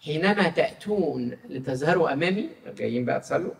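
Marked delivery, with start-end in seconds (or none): 0.0-2.5 solemn scripture reading. Acted. Reads Isaiah aloud in sermon. (قراءة كتابية مهيبة ببطء)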